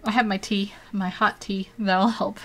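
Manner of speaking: usual speaking voice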